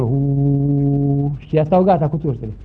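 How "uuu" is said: low droning oo; airplane drone. Retold or imitated. Boy riding on the airplane in the game, airplane drone. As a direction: hum like an engine. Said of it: "își face ca avion".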